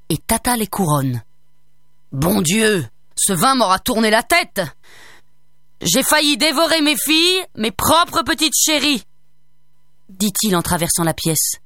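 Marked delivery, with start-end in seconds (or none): 2.1-9.0 a tipsy ogre shaking his head; lightly hammed drunk ogre; befuddled ogre. A narrator's reading.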